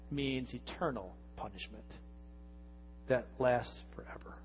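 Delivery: hushed, grave whisper. Real, not acted. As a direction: drop to a solemn whisper. Solemn warning about eternal punishment.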